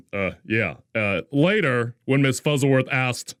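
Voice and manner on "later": ordinary delivery, nothing marked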